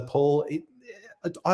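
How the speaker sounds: normal talking voice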